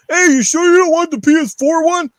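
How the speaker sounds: high, loud mock clerk voice, fake-friendly. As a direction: play the pushy PlayStation salesman, hammed up. Mocking pushy store clerk.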